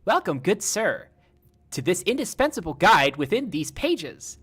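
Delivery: TikTok robot lady. Retold or imitated. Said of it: "that TikTok lady's voice".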